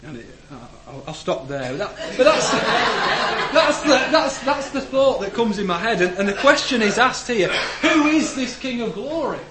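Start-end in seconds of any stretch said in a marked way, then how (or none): 1.8-9.4 laughing. Real, not acted. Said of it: "still laughing after goat voice".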